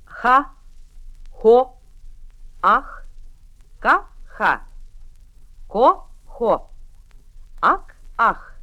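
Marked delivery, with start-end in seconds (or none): none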